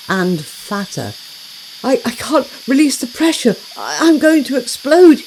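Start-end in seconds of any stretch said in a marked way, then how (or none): 1.8-5.2 higher and louder, stammering in alarm; about to burst, getting it out in a rush; spluttering panic. Acted. Swollen hosepipe warns his friends he will explode, panicked. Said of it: "he spluttered".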